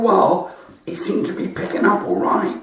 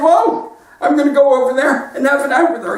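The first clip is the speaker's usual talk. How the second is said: higher, louder nagging wife voice; high mock wife; 9 semitones higher, 4 dB louder than usual. Joke wife snapping at her husband, higher and louder. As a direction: squeak it out like an indignant wife.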